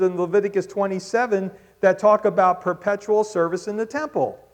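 loud, high rhetorical preaching; preaching at full force. Acted. Preacher challenging the congregation's doubts, loud and high. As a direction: press the question on them. Preacher arguing against critics.

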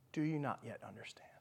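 fading whisper. Retold or imitated. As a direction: let the whisper die away. Repeat of the question, fainter.

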